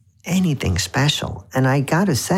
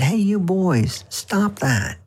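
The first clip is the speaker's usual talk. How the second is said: higher, drawn-out mock telling-off; imitated scolding; 7 semitones higher, much slower than usual. Woman to boys breaking branches in the park, mock scolding. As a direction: call out a mild reprimand, imitating a passer-by.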